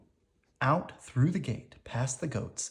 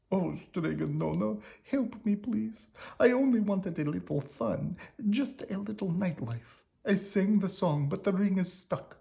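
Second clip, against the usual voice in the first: higher, whining plea for help; Big Anthony begging Stregonona to fix the ring, whining; pleading Big Anthony; 8 semitones higher than usual. A narrator's reading. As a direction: beg the witch like a sorry boy.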